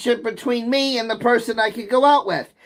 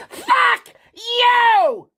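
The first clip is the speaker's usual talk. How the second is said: a sudden much higher screaming fuck you; furious outburst; 12 semitones higher than usual. Real, not acted. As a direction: explode at the trolls. Rant climaxes in outburst.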